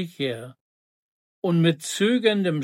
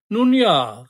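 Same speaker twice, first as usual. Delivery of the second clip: louder, higher grudging consent; raised guards' reply; calling out; 4 semitones higher, 6 dB louder than usual. A narrator's reading. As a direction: call it out to agree. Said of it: "riefen sie".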